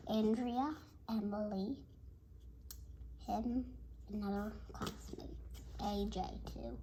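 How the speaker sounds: ordinary delivery, nothing marked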